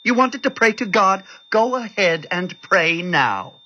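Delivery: high-pitched mocking challenge; bitter taunt. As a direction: goad the believer, drawing out the end. Bitter doctor taunts praying nurse.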